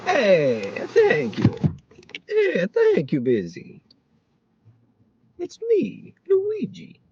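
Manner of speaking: high, hammed-up Italian accent. Driver jokes to the camera in a hammed-up Italian accent. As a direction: go full cartoon Italian, big and bright. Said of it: "I gotta do my Italian accent".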